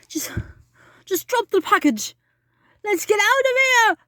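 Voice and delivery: high, loud frightened urging; scared child pleading to leave; panicked plea. Acted. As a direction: beg to flee in a panic. Scared kids in haunted house.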